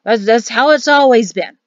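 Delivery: put-on loud, indignant old man; sputter, then challenge him hotly; flustered bluster rising into accusation. A narrator's reading. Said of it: "Danny hemmed and guffawed and harumphed".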